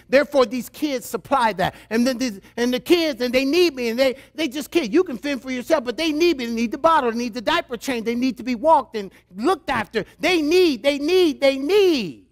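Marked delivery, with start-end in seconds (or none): 2.6-12.3 higher-pitched imitation of a fussing mom; mock-urgent, repetitive maternal insistence; insistent mother. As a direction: plead the kids' case like a devoted mother. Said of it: "And mothers, I know how you are".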